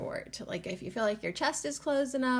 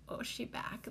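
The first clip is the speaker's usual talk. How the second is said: soft unvoiced whisper, much softer; sassy whispered aside; 2 semitones higher, 10 dB quieter than usual. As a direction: breathe it like a secret. Whispered slang repeat.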